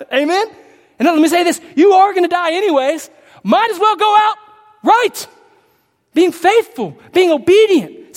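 rally the congregation with fire; full-force preaching. Acted. Preacher urging church to go out boldly, fervent shout.

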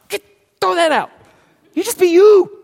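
high, loud insistence; high-pitched, loud preaching push; preaching peak. Real, not acted. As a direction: throw it out and lift them.

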